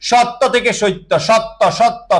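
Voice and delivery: সত্য said with the ত clearly doubled as সত্ত. Shown as a demonstration. Teacher drilling students on the doubled ত. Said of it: "ত কয়বার?".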